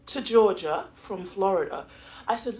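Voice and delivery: ordinary delivery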